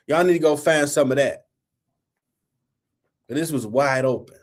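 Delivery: ordinary talk, nothing marked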